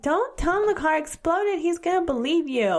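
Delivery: high, exasperated retold warning; mock-alarmed protest. Wife retelling her warning to husband, mock-alarmed.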